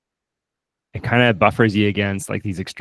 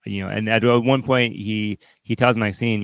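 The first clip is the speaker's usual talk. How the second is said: ordinary talk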